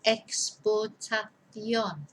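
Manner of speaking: slow, syllable by syllable; careful teacher dictation, drawn out. Shown as a demonstration. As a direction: spell it out with the voice. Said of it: "dictation, slowed down".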